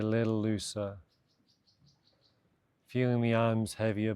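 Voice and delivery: calm, measured meditation voice with long pauses; guided meditation. Real, not acted. Meditation teacher guiding listeners to de-stress after a busy day, calm and spacious. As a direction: ease them gently through each instruction, unhurried. Leads a body scan meditation.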